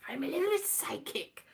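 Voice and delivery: whispering his claim; breathy whispered confidence; whispered quote. Retold or imitated. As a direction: hush it like a secret. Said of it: "Heathcote Williams was".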